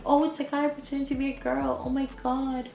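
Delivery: high, mock-scandalised voice; mock shock. Disowning shock at a guy pretending to be a girl, mock scandalised. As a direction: put on exaggerated shock at the news.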